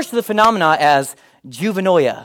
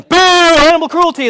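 higher, louder protest call; mock protest yell; 11 semitones higher, 7 dB louder than usual. Preacher retelling his SeaWorld protest, mock yell. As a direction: boo like a picketer. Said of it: "I was yelling".

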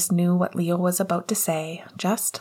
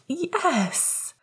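high, delighted breath; high-pitched astonished exhale; breathed surprise; 11 semitones higher than usual. A narrator's reading. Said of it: "she breathed".

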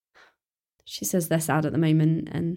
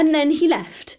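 distressed; 7 semitones higher, 4 dB louder than usual. Acted. Upset girl explains dad left.

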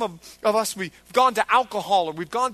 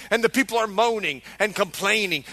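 ordinary talk

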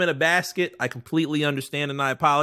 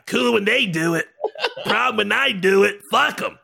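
raised, pushier mock belligerent take; 6 semitones higher than usual. Friend mimics Mike being belligerent, raised and pushy. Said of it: "Mike, you are acting belligerent right now".

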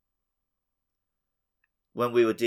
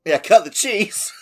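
laughing punchline; 7 semitones higher, 9 dB louder than usual. Real, not acted. Punchline fart joke.

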